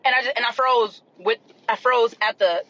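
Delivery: ordinary talk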